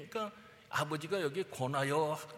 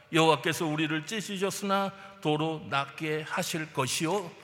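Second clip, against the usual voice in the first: preaching at full force; 6 dB louder than usual. Acted. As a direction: thunder the verse out to the congregation. Proclaims Hosea aloud.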